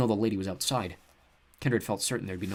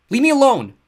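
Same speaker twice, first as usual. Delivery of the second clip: loud tearful shout; angry cry; 15 dB louder than usual. A narrator's reading. Seth shoving his sister away, crying shout. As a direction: push her off with a yell.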